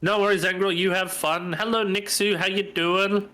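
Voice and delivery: ordinary talk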